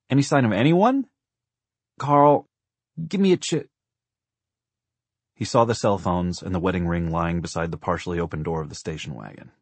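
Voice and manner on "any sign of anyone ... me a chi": loud, high, raised-voice exchange; calling out. A narrator's reading. Husband and wife call between car and wagon, raised voices. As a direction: call across the distance.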